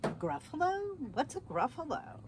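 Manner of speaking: high, puzzled "A Gruffalo?" from the fox. Acted. The fox, baffled, asking the mouse what a Gruffalo is, puzzled. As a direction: ask it as a baffled, curious fox.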